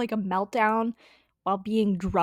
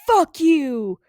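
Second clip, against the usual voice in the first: higher, louder curse; retold furious outburst; angry yell; 7 semitones higher, 6 dB louder than usual. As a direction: spit it at him. Swearing at principal.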